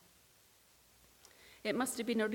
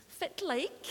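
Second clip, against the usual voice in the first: quick Doric greeting in Aberdonian twang; Doric accent; 7 semitones higher than usual. Retold or imitated. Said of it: "talking in her Aberdonian twang".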